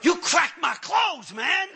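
high, loud, slow outraged protest; mock steaming mad. Man to pastor who yanked his arms, mock-angry shout. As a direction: holler in hammed-up indignation. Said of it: "Now I'm steaming mad".

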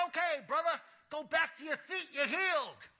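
high-pitched preacher proclamation, mimicked; fervent healing pastor. Pastor dismissing the man as healed, proclaiming. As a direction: send him off with preacherly fervor.

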